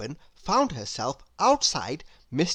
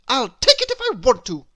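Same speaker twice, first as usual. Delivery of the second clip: loud, higher, defiant snort; snorting defiance; 8 semitones higher, 8 dB louder, faster than usual. A narrator's reading. Little Miss Stubborn defies Mister Sneeze, loud and snorting. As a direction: toss it back defiantly. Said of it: "snorted little Miss Stubborn".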